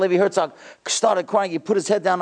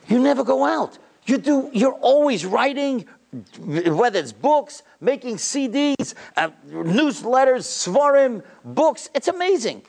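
high-pitched, drawn-out marveling; amazed admiration; 8 semitones higher, slower than usual. Retold or imitated. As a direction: marvel at his tireless work, voice pitched up. Retelling his amazed questioning of the rabbi.

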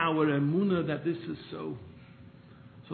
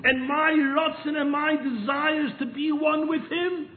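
loud, high impassioned plea; preaching at full force; fervent preaching; 11 semitones higher, 7 dB louder than usual. Acted. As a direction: pour the heart out before God. Preaches the rebbe's words.

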